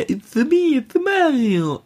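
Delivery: high, squeaky Italian plumber voice; Mario voice. Retold or imitated. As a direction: go way up, slow on the name. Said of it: "Mario eigentlich nie vernünftig sprechen konnte".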